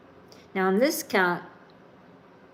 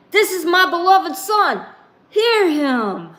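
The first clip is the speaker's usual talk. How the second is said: much higher and louder divine declaration; the Father vouching for his son, high and loud; booming proclamation; 9 semitones higher, 6 dB louder than usual. Acted. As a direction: thunder it from the cloud.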